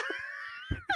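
helpless high-pitched laughter; high laughing fit. Real, not acted. Podcaster cracking up at the campy Kitka scene, helpless laughter. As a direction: crack up laughing, nearly crying.

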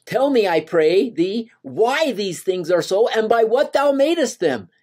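high, pleading voice of Moses asking God. Acted. Moses asks the Lord why, pleading high. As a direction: beg the Lord for answers, voice lifted high.

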